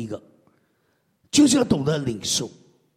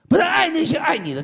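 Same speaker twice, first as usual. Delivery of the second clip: preaching shout; 9 semitones higher, 3 dB louder than usual. Real, not acted. Preacher stresses loving others.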